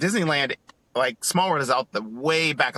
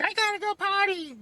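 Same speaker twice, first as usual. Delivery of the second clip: put-on high little-kid voice, much higher; little son's voice. Son tells mom he needs the potty, high kid voice. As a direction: squeak it like a small boy who needs the bathroom. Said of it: "my son was like".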